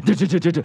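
quick high "do do do" hum; mock writing hum. Mimicking his friend scribbling fast. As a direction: hum it like a pen racing.

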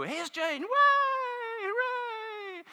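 high announcement into a squealed mock cheer; gushing show introduction. Talk show host introduces Jane, mock high cheering. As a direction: mimic a host and her overexcited crowd. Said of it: "introduces her first guest".

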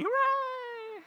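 squealed, very high mock hooray; cheering studio audience. Audience applauds the guest's cookery show, mock cheering. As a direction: parody a mindlessly delighted crowd. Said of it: "Everybody goes".

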